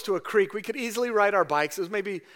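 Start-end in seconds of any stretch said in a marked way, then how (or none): none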